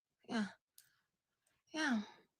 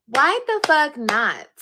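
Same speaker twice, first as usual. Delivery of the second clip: slow and louder, each word leaned on; slow, loud repeat; 3 semitones higher, 8 dB louder, slower than usual. Real, not acted. Repeating her defiant question to viewers, slow and louder. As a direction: hammer the question home, slowly and loud.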